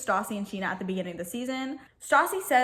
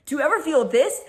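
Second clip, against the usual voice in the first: louder, a touch higher, jeering; taunting challenge; 4 semitones higher, 6 dB louder than usual. Retold or imitated. Retelling Sandoval taunting Jax, loud jeer. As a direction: needle him before the punch. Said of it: "And then he says".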